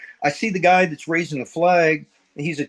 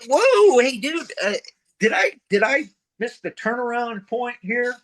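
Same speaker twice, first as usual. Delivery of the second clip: mock astonishment; 8 semitones higher than usual. Runner questioning a too-quick time, high mock astonishment. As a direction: act out startled disbelief, pitched way up. Acts out shock at fast run.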